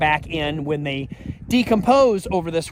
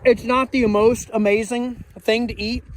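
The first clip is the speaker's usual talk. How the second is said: mouth full; 4 semitones higher than usual. Real, not acted. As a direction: still chewing, mouth full. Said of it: "with my mouth full of food".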